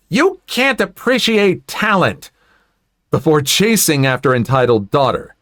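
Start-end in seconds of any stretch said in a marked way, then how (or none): 0.1-2.3 high-pitched outraged parent; shrieky put-on protest, without much volume; offended mock yell. Entitled parent scolds the audience over her daughter, high offended mock yell. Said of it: "takes a microphone and yells".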